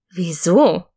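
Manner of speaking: a touch of puzzled voicing; child puzzled by the gift given away; confused question. A narrator's reading. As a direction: ask why, baffled. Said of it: "fragte ich verwirrt".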